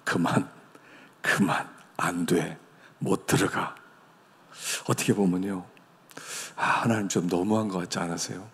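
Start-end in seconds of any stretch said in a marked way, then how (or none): 0.0-3.8 low, slow stern refusal; God refusing. Retold or imitated. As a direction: shut him down, slowly and quietly. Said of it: "하나님이". (낮고 느리게 단호히 거절하는 하나님)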